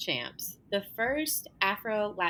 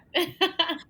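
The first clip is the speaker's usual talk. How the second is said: a high, loud burst of laughter; bright real laugh; 10 semitones higher, 5 dB louder than usual. Real, not acted. Guest laughing at the host's football joke, real laugh.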